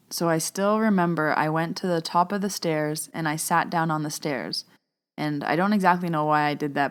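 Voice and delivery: normal talking voice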